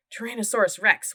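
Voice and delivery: ordinary delivery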